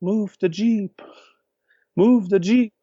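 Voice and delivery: high, slow shouted call; yelling from the glider. Retold or imitated. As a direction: yell it up to friends on the ground. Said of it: "yelling, uh".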